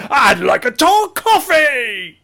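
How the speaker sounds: mock shouting a Starbucks coffee order; mock loud order. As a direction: bellow a coffee order goofily. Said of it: "imagine you going into Starbucks and going".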